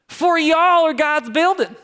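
high, loud, drawn-out Southern drawl; Southern lady. Acted. Pastor reads scripture to congregation as a Southern lady, Southern accent. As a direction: stretch it out like a nice Southern lady. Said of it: "like a nice Southern lady saying y'all".

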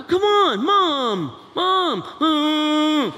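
high, loud repeated Mom; teen whining at mom. Acted. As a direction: nag like a sulky teen.

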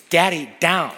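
high, loud imitated toddler order; insistent toddler. A toddler insisting to her dad, imitated demand. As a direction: mimic a little one who won't budge. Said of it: "It's very insistent".

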